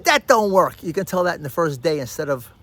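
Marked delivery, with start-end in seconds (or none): 0.0-0.8 loud, high instant dismissal; acted rejection. Dater reacting on a bad date, loudly dismissive. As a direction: blurt out a firm mock refusal.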